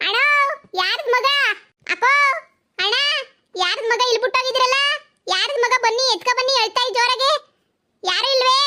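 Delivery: high, loud call across the street; hollering to the neighbours about a found baby. Acted. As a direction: shout for the owners to come. Calling out to neighbours.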